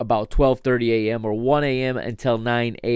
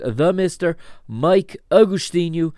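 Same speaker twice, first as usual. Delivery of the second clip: higher announcer name drop; podcast host intro; 6 semitones higher than usual. Acted. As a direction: present yourself like a radio host.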